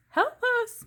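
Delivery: high, loud cry for help; calling for help. A narrator's reading. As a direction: call out for rescue. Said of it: "Missus Watson called".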